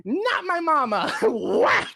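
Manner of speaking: high, loud mocking wail; mock creature outcry. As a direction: wail in protest, then hit a loud whack. Mocking the creature's attack cry.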